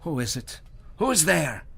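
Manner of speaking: startled Herschel. Acted. Herschel wakes to a sound, alarmed. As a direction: call out into the dark.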